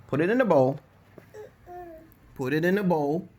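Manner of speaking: firm order. Real, not acted. Repeating order to daughter.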